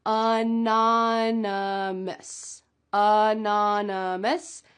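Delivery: anonymous and unanimous drawn out slowly; teacher slow pronunciation demo; slow careful contrast. Shown as a demonstration. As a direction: stretch anonymous then unanimous so each vowel shows. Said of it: "let's talk about the pronunciation".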